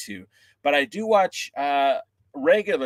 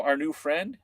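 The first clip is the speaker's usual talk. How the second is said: ordinary delivery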